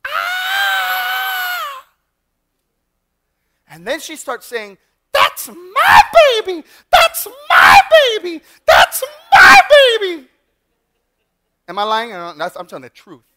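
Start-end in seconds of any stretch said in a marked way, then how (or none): 0.1-1.8 long, piercing high-pitched scream; sustained full-voiced shriek; horrendous woman's scream. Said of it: "I hear this horrendous scream". 5.1-10.3 very high, drawn-out full-force scream; repeated piercing screams, each word stretched; screaming mother. Retold or imitated. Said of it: "it's a scream of a woman".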